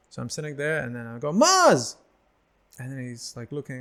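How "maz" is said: high call across the room. Retold or imitated. A fan yelling for the comedian to notice him. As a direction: shout his name to catch his attention.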